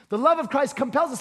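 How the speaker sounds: brisk run-through of the phrase; rapid, even recap; quick restatement. Real, not acted. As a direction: repeat it quickly without dwelling on "compels". Quick repeat of the quote.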